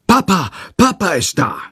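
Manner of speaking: put-on high, excited child's call; narrated happy shout, not full volume; joyful cry. A narrator's reading. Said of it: "schreit freudig durch die ganze Wohnung".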